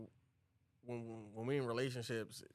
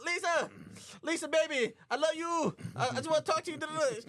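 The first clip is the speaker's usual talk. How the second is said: high, louder pleading call; mock lovesick calling; 5 dB louder than usual. Roland calls to his bride through the door, high pleading. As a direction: beg her through the closed door. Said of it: "Ro like this".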